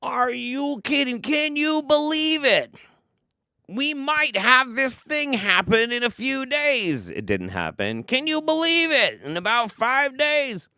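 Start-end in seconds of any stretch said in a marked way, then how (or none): 0.0-7.1 Paul Begley. Acted. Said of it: "he talks like David Lynch". 8.0-10.7 Paul Begley. Acted. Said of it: "he talks like David Lynch".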